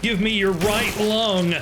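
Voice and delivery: high, drawn-out hammed-up cry; =stretch it out in a mock war cry; mock demand. Mock war cry while looting body parts.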